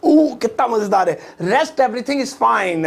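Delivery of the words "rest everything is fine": very slow, savouring each word; slow model repeat. Shown as a demonstration. Teacher repeats the translation for learners, slow and drawn out. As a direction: stretch the sentence out, holding "fine।".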